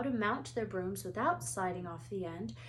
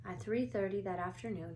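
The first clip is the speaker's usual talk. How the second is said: ordinary delivery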